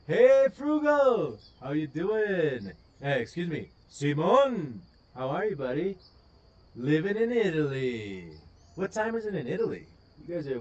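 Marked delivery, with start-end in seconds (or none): 0.1-6.0 excited greeting. Real, not acted. Greeting chat viewer.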